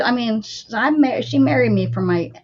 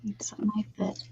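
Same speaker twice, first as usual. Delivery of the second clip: whispering; 2 semitones lower, 8 dB quieter than usual. Real, not acted. Whispering while crafting.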